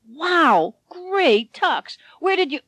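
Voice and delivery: high, excited gasp of surprise; amazed little man. A narrator's reading. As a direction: Ken bowled over by the tux. Ken amazed at Harvey's tux.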